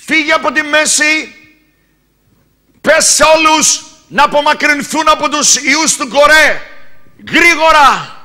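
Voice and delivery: high, loud command to flee; urgent divine command. Acted. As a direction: order everyone away at once. God commands Moses urgently. (επείγουσα προσταγή, δυνατά)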